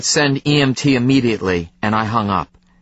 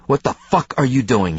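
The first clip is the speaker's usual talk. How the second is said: plain reading voice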